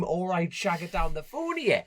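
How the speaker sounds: half-strength retold scream, slightly high; mock scream. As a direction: quote the phone scream without fully yelling, drawn out. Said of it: "How many Geordie knackers scream".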